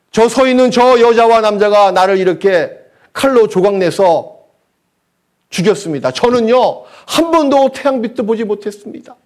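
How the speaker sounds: acted plea building from hushed to high and loud; aborted baby crying out. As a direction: cry out a wronged child's accusation to God, rising in pitch. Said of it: "나타나서 이렇게 외칠 겁니다". (원통함을 하나님께 점점 높여 외치는 태아 연기)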